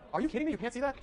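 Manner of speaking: high-pitched disbelief; incredulous. Real, not acted. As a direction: ask it in disbelief, pitch shooting up. Reacts to screen being unreadable.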